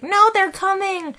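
high, slow whiny voice; high-pitched woman. Retold or imitated. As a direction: squeak it out, slow and indignant. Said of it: "She's like".

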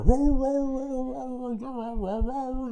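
high, loud run of mock barks; dogs barking. Body shop dogs barking at the man, high mock barking. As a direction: bark on and on like a yard of dogs. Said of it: "and the dogs are".

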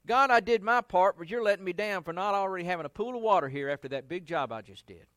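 loud, fast, aggrieved complaint; hammed-up indignant whine; mock resentful outburst. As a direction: scold God like he let you down. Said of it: "It's almost if he's saying".

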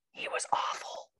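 confiding whisper; whispered aside. Real, not acted. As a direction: whisper it like a catty secret. Aside judging another speaker.

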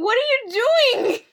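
high, exasperated demand; annoyed at the movie mother, high and exasperated; mock outrage. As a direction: act mad at the screen. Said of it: "I was so mad".

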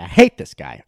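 loud hate. Real, not acted. Venting about the bowling dad, loud and fed up. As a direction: blurt it out, fed up. Repeating hatred, third time louder.

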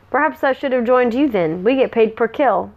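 ordinary delivery, nothing marked

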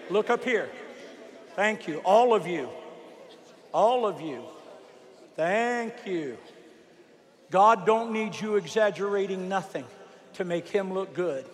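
dropped to a soft low voice; quiet and soft. Real, not acted. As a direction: bring it down gently. Winding down, soft.

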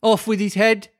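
high-pitched imperious Queen shout; high, put-on royal command. A narrator's reading. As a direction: order an execution, voice pitched high. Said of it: "quarrelling with the other players and shouting".

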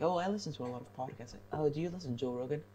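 teasing imitation of a sister who brags about podcasts; mocking the sister. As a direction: rattle it off like a know-it-all sister.

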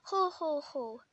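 mock Santa chuckle; jolly laugh. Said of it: "he smiled and laughed".

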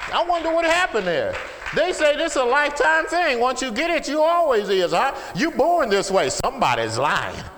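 high, loud pulpit shout; impassioned shouted testimony; full-force preaching. Real, not acted. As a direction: cry it out to the whole congregation.

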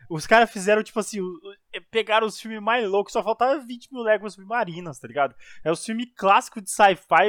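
ordinary talk, nothing marked

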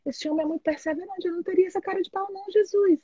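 high, fast, half-whispered disbelief; exasperated plea to God. Retold or imitated. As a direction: complain to heaven, rushing, amazed. (desabafo exasperado e acelerado com Deus)

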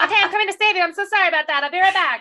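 high, loud mock apology; mock frantic. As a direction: ham up a flustered rescue. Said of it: "She's like".

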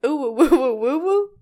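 loud sing-song ooh-woo call; playful hooting. Acted. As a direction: hoot it out like a cartoon owl. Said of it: "let's play parts".

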